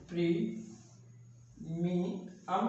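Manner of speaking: "प्री, मी, अम" with long gaps between parts; syllable by syllable. Shown as a demonstration. Teacher writing out the word, "प्री, मी, अम" in separate syllables. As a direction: dictate "प्री, मी, अम" one piece at a time. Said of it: "तीन syllables में इसकी आवाज़".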